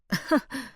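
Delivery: high, mocking little laugh. A narrator's reading. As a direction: laugh at them, amused. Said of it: "Rosalie laughed".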